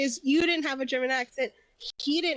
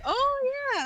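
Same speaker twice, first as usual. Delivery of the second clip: high-pitched retold realisation; a drawn-out dawning realisation, played up; mock realisation; 13 semitones higher, 3 dB louder than usual. Friend recalling his forgotten real voice, mock surprise.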